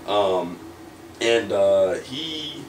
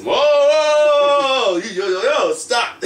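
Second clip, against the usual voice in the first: much higher, louder, drawn-out whoa; startled protest; 6 dB louder, much slower than usual. Retold or imitated. Wrestler waving off Bret's praise in loud mock alarm. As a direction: stretch each whoa like slamming on brakes.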